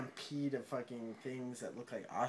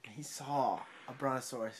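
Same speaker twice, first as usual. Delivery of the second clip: ordinary talk, nothing marked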